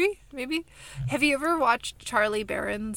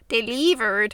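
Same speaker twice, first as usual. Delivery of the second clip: louder, odd Canadian-sounding slip; Canadian accent; 5 dB louder than usual. Real, not acted. Host trips over the word delivered, Canadian accent. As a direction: say "Delivered" with an accidental Canadian twang. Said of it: "She's trying to do the Canadian accent".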